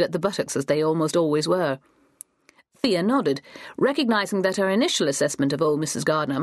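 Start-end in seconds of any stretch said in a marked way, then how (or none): none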